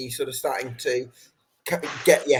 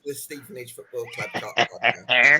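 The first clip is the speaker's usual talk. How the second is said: laughing. Real, not acted. Host mocks guest's modest title, laughing. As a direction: crack up while saying it.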